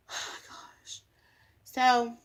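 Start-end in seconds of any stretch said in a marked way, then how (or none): 0.1-1.0 breathy whispered "oh gosh"; weary whispered groan; whispered sigh. Real, not acted. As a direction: let it out in a whisper, worn out. Exasperated aside.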